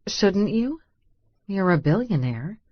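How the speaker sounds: ordinary delivery, nothing marked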